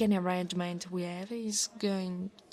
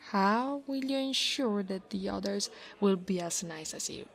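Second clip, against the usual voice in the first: ordinary delivery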